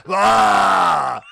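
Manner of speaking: high, loud acted cry; mock monster yell. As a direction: let out a hammed-up yell. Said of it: "and then go".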